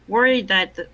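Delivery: normal talking voice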